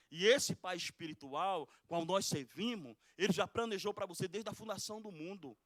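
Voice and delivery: loud pulpit preaching, driving each phrase; a fired-up sermon, pressing the point; preaching at full force. Acted. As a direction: preach it out to the congregation.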